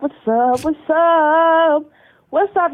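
normal talking voice

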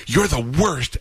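high-pitched mock outburst; mad daughter. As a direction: imitate a daughter flinging an accusation. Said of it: "she got mad at me".

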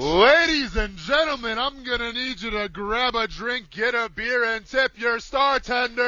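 high, slow stadium PA announcer; baseball announcer voice. Pretend. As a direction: boom it out like a ballpark announcer. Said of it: "in my baseball announcer voice".

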